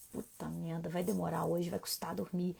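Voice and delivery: hushed, muttered-under-the-breath frustration; quiet irritated aside; inner thought. Retold or imitated. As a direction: voice the annoyed thought almost to yourself. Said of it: "Eu acabo de pensar isso".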